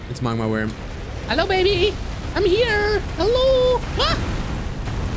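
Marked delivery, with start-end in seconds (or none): 1.3-3.8 squeaky high put-on voice, much higher; cutesy greeting. Player greets the boss in a squeaky cutesy voice. 4.0-5.2 startled yelp. Real, not acted. Startled by the boss.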